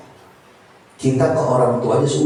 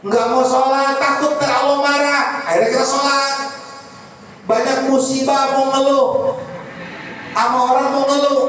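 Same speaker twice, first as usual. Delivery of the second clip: high, insistent sermon voice; insistent preaching; 12 semitones higher than usual. Acted. Preacher urging all to recite salawat out of fear, insistent preaching. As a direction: punch each repeated "takut" high.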